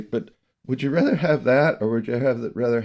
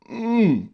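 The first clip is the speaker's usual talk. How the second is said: put-on baby grunt; 12 semitones higher than usual. Imitating baby's grunt.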